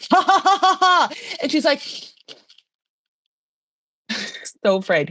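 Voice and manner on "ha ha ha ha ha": high, staccato put-on "ha ha ha"; mock forced laugh. King showing a nervous guest who laughs, mock forced laugh. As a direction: imitate a pointed fake laugh.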